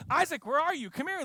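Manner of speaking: high, loud call across the field; retold shout to Isaac, high and loud. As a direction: call the kid over to you. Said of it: "and I'm like".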